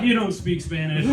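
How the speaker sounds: loud, mock-confident kid announcement; nine-year-old's declaration. Nine-year-old walking around Madrid, loud blunt declaration. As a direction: announce it loudly at strangers. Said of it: "I was just this nine-year-old".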